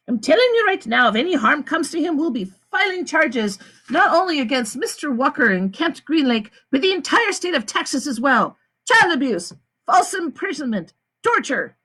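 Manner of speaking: a high, firm woman's threat; the lawyer. A narrator's reading. The lawyer threatens the warden, high and firm. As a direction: lay down the charges.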